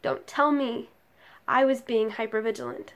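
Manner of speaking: emphatic recital, hitting key words hard; forceful slam-poetry delivery; impassioned spoken-word poem. Acted. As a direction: deliver each phrase as an indictment, pausing between. Poem builds to accusation.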